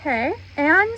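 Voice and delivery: ordinary talk, nothing marked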